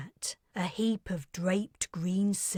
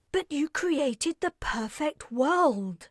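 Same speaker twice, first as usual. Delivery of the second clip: higher, astonished outburst; exclaiming; 8 semitones higher, 4 dB louder than usual. Acted. Carrie exclaiming to Aparte in disbelief. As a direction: she cannot believe what she hears. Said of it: "exclaimed Carrie".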